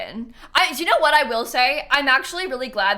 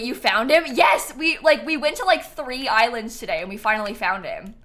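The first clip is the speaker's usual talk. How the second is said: usual speaking voice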